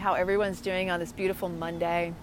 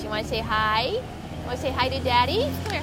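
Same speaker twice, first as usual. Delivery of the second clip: pet talk; 4 semitones higher than usual. Real, not acted. Coaxing dog to greet Daddy.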